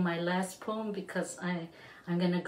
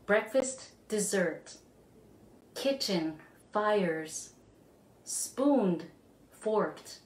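soft, spare recitation with a pause after each word; poetry reading; 2 semitones lower, 3 dB quieter, slower than usual. Acted. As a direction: let each single word hang in the air. Reads a very short poem.

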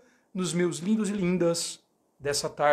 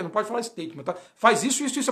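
normal talking voice

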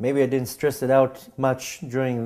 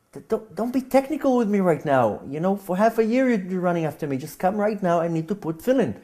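higher, urgent, impatient pleading; exasperated insisting; 6 semitones higher than usual. Retold or imitated. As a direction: cut off his technicalities, you need this now. Desperate self arguing with friend.

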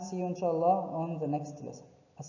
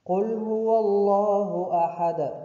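Quran recitation; 2 semitones higher, 5 dB louder than usual. Acted. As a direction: recite it out fully. Recites the verse.

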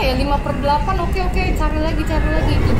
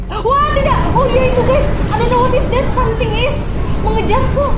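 panic; 8 semitones higher, 4 dB louder than usual. Real, not acted. Chased by ghost, panicking.

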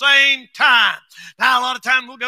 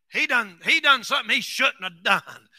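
ordinary talk, nothing marked

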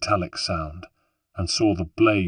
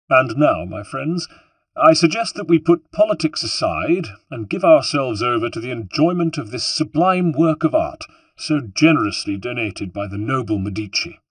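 smug host, higher and louder, addressing the crowd; lightly voiced public speech; 6 semitones higher, 8 dB louder than usual. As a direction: raise the voice to toast the guests. Alberti addresses the gathering.

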